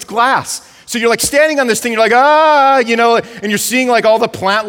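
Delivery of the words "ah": loud, high "ah" of mock fright; mock terrified scream. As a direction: cry out as if scared of what's below. Acting out fright on glass-bottom boat.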